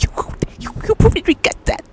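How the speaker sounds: high, ominous warning from the inner voice. Retold or imitated. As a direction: spook them off it, up high. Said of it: "the tingling sensation telling you".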